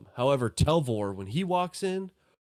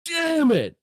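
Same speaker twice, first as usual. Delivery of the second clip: shrill startled yelp, pitched way up; startled NPC; 11 semitones higher, 4 dB louder than usual. Acted. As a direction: jump out of your skin, voice cracking high. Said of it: "Telvor's like".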